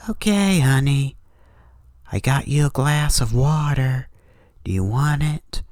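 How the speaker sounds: high, sugary mom voice; sweet mother. Acted. Thing mimicking mom offering water, high sweet voice.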